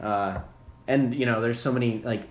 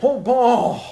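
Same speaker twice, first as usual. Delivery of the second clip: excited; 10 semitones higher, 8 dB louder than usual. Acted. Hammed-up exclamation.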